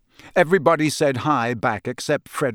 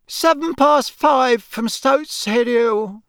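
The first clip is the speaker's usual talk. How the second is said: high, slow, drawn-out official call; announcer; 9 semitones higher, 3 dB louder, slower than usual. Acted. Official announcing an arrival from the hill, calling out.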